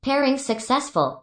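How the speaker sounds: ordinary talk, nothing marked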